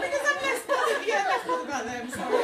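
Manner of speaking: words squeezed out through high laughter; giggling helplessly while apologising; laughing. Real, not acted. Speaker to guests, joking about the aunties, laughing hard. Cracks up mid speech joke.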